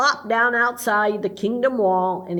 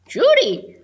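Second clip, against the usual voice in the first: calling out; 11 semitones higher, 5 dB louder than usual. Acted. Impatient characters demanding deliveries.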